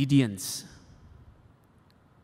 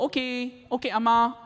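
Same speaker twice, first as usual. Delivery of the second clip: imitating son; 8 semitones higher than usual. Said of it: "And he would go".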